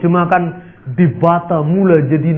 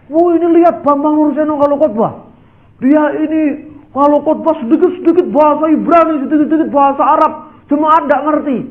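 higher, sneering imitation of a critic; mocking preacher; 8 semitones higher than usual. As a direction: mimic a jealous pastor's jab. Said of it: "ada pendeta yang nyindir". (menirukan pendeta yang menyindir, sinis)

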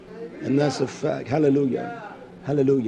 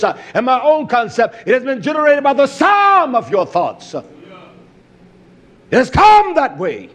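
forceful preaching; 7 semitones higher, 6 dB louder than usual. Real, not acted. Preacher warning enemies of Yah of hell, forceful preaching. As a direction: preach a fierce warning, surging on key words.

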